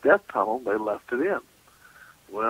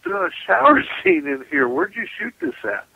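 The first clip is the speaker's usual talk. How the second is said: mock disbelief; 13 semitones higher, 5 dB louder than usual. Retold exclaiming at the director.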